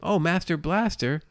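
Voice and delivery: high, slow hammed-up quote; mock character voice. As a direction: stretch it out high and silly. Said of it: "it's like".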